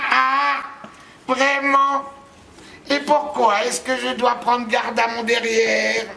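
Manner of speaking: high, sour little witch voice; shrill nasty witch. Acted. Witch catching the narrator singing, high and spiteful. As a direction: sneer it in a small high spiteful voice. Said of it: "une petite voix aiguë, aigre et méchante".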